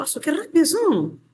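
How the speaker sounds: high, bright exclamation of delight; delighted teacher praising fast students; pleased surprise. Real, not acted. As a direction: react as if amazed at their speed. Teacher reacting to quick answers.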